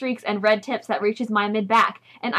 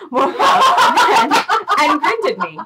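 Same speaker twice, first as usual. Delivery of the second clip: high, loud, slowed laugh through the words; 7 semitones higher, 8 dB louder, slower than usual. Real, not acted. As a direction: break up laughing as you read. Cracks up mid-reading.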